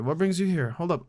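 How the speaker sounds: ordinary talk, nothing marked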